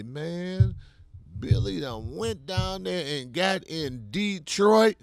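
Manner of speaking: lightly voiced old schoolmate marvelling; mock old classmate. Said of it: "people gonna pay attention to and say".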